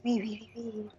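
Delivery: whispering oui with a fake French lilt; breathy mock French chant; whispered mock French oui. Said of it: "make fun of Widowmaker".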